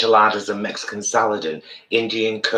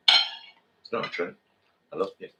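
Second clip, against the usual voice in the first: tender; 7 dB quieter than usual. Real, not acted. Tender reassurance to viewers.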